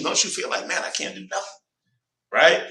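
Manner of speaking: normal talking voice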